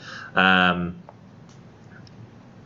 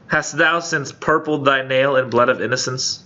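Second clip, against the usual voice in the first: mock dramatic reproach; 5 semitones higher than usual. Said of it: "poet reproaching woman".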